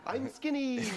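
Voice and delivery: high, squeezed mock boast; high-pitched pretend skinny voice; mock skinny poser. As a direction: pipe it up high, as if holding a pose. Mocks overweight person posing for photo.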